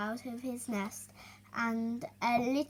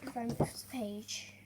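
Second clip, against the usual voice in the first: ordinary delivery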